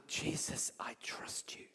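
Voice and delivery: soft whisper, barely voiced; whispered prayer. Shown as a demonstration. Preacher shows the congregation a simple trusting prayer, whispered. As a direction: breathe the words like a private prayer.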